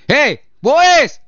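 loud, high, drawn-out call; high mock telling-off shout. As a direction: call out sharply to someone who is out of line. Calls out to the message sender.

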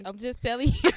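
loud, laughing insistence; insistent. Real, not acted. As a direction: laugh while you insist. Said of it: "this is so nasty".

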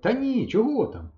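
high, slow, eager blurt; blurted out eagerly. A narrator's reading. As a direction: blurt it out, keen to help. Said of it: "вихопилося в мене". (хлопчик палко вигукує)

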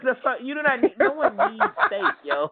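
telling the woman nobody needs steak, incredulous; indignant disbelief. Retold or imitated. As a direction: scoff at the demand in disbelief. Indignant reply to steak demand.

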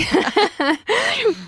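high-pitched laugh; amused laugh. Real, not acted. Teacher amused by Bond answer.

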